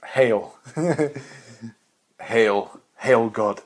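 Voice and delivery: loud, hammed-up fan hailing; mock worshipping fan. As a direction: cry out like a devoted follower.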